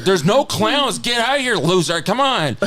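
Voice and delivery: high, mock-impatient sister voice; teasing older sister. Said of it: "And then my sister's like".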